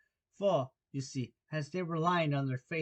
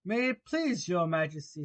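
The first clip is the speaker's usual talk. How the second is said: slightly raised, humble plea; 3 semitones higher, 4 dB louder than usual. Acted. Gardener kneeling before the Queen, very humble. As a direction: address royalty meekly. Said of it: "in a very humble tone".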